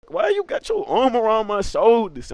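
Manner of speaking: high-pitched protest in a retold quote; indignant question. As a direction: voice jumps up, acting out the objection. Acted indignant question to the cousin.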